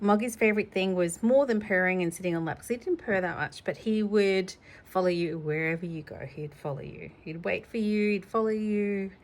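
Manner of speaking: normal talking voice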